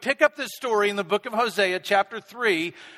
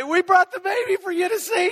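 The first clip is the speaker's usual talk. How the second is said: pitched way up, pleading over the screaming; high pleading; 11 semitones higher, 3 dB louder than usual. Retold or imitated. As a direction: squeak it out, begging to be heard. Pleading amid in-law's screaming.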